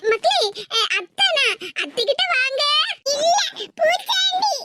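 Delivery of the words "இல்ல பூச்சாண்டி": put-on high, slow little-child voice; a small boy refusing his aunt, drawn out; toddler voice. Toddler grandson calling his aunt a bogeyman, high and childish. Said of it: "நான் பூச்சாண்டியா?".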